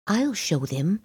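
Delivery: plain reading voice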